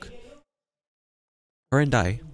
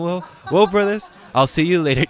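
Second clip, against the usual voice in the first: higher, louder casual farewell; normal voice after prayer; 9 semitones higher, 7 dB louder than usual. Retold or imitated. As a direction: switch to an everyday chatty voice. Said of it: "my voice just changed".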